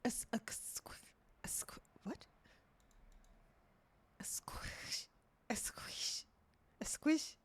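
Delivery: whispered tries at a squish; real whispered rehearsal; whispered practice. Real, not acted. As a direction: whisper it to yourself. Whispers to test pronunciation.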